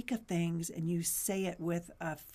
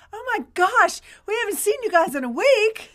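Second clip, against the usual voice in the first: surprised; 12 dB louder than usual. Pretend. Imagined viewers exclaiming.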